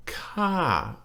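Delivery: "ka" starting high and dropping; long, falling "ka". Shown as a demonstration. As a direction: draw out "ka" as it falls. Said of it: "falling tone".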